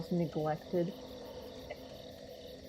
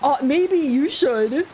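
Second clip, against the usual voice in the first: mock voice; 6 semitones higher, 10 dB louder, much slower than usual. Mocking retort in another voice.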